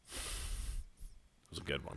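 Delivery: slow, soft whispered satisfaction; quiet pleased whisper; smug whisper. Real, not acted. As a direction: savour it under your breath. Pleased with his prank.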